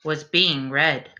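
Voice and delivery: slow, lilting bedtime rhyme reading; story narration. A narrator's reading. Reading a rhyming bedtime book to the class, gentle storyteller voice. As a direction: read it like a picture book to little ones.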